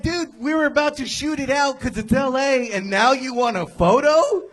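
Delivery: can't believe the nerve of this guy; incredulous. Retold or imitated. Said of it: "And I'm like".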